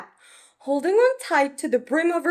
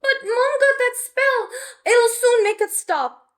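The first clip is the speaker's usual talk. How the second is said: put-on higher, hopeful young witch; anxious little witch; 10 semitones higher, 4 dB louder than usual. Betty reaching for the mop, higher and hopeful. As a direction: reassure herself quickly.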